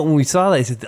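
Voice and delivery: usual speaking voice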